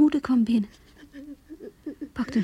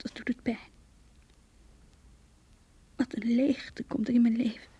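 faint aching whisper, much softer; whispered pain; 4 dB quieter than usual. Acted. Rachel whispering her heartbreak into her diary. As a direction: breathe it out like a wound.

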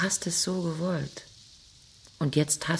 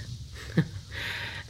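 quiet scornful laugh under the breath; breathy mocking laugh; 5 dB quieter than usual. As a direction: let out a soft, contemptuous laugh. Wife mocks Peter. (leises höhnisches Lachen)

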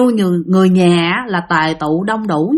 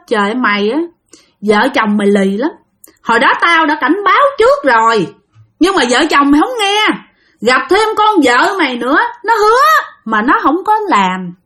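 bà già mắng; 7 semitones higher than usual. Retold or imitated. Hồn bà già mắng cậu Sáu.

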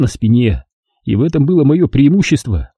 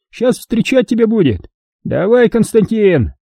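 put-on high, shrill old woman whoop; 11 semitones higher than usual. A narrator's reading. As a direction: holler it like a spiteful old woman.

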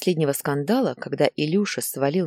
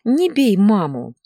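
high, drawn-out loud plea; crying child; 6 semitones higher, 6 dB louder, slower than usual. A narrator's reading. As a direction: wail it slowly like a tearful boy. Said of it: "заплакал Илья". (протяжная детская мольба)

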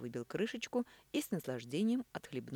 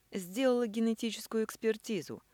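snide drawl; 7 semitones higher, 6 dB louder than usual. A narrator's reading. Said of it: "ехидно протянула Элина".

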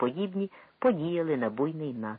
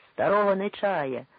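1 crowd shout; 6 semitones higher, 4 dB louder than usual. A narrator's reading. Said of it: "чулося з одного гурту".

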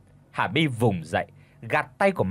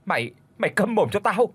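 angry outburst; 7 semitones higher than usual. Acted. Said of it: "uất ức gầm lên".

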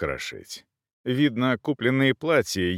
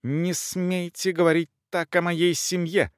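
high, slow indignant protest; affronted lady crying out, drawn out; offended exclamation; 7 semitones higher than usual. A narrator's reading. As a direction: defend the family honour in a high voice. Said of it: "воскликнула Элеонора Августа".

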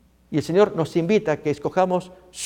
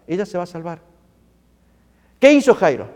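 usual speaking voice

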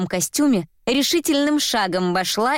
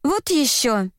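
higher, pouting retort; capricious pout; 6 semitones higher, slower than usual. A narrator's reading. Katya talks back to the man, capriciously. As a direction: toss it back like a spoiled girl. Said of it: "Катя капризно надула губки".

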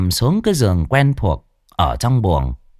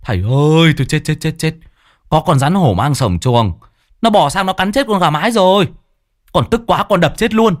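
put-on shrill boy report; 6 semitones higher than usual. Boy excitedly reports the cobra to his teacher. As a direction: rattle off the news breathlessly. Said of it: "kêu choe choé".

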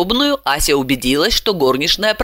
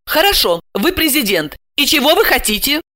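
higher-pitched, impatient; cross question; 8 semitones higher than usual. A narrator's reading. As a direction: ask it testily. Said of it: "поэтому сердито спросила". (сердито, нетерпеливо)

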